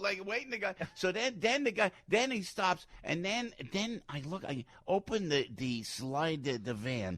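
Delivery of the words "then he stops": usual speaking voice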